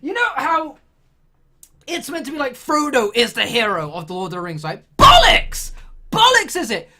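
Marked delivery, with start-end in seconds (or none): 5.0-6.9 high, loud scoffing shout; shouted rejection mid-rant; indignant outburst. Real, not acted. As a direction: explode in comic disbelief. Outburst rejecting Frodo as hero.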